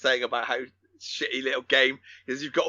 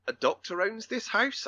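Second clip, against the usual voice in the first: slow, higher-pitched incredulous question; retold disbelief; 7 semitones higher, 4 dB quieter than usual. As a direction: imitate someone baffled by what they hear. Retold man astonished at the house.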